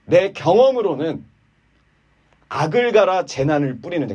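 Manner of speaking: arrogant. Acted. Eliphaz lecturing Job from experience, arrogant. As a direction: lecture from on high, slowly. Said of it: "아주 거만한 말을 하죠".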